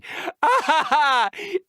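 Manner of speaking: short rising laugh at his own joke; chuckle, pleased with the rhyme; delighted giggle. Acted.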